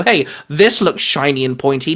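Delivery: usual speaking voice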